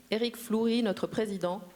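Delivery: ordinary talk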